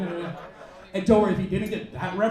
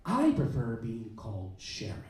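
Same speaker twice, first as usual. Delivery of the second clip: dry waitress; 10 semitones lower, 6 dB quieter than usual. Retold or imitated. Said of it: "she's like".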